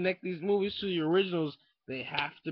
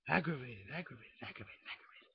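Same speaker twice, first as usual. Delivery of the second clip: fading repetition; 5 semitones lower, 7 dB quieter than usual. Acted. Repeating the word, fading out.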